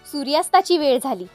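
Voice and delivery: ordinary delivery, nothing marked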